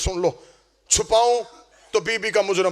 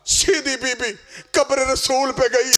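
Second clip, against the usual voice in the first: shouted lament climax; 5 semitones higher, slower than usual. Acted. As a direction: cry the words out as a drawn mourning call. Climax of recitation, shouted chant.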